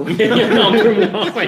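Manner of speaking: laughing. Real, not acted. Cohost laughing about fewer pigs on the escalators. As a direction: giggle your way through the line.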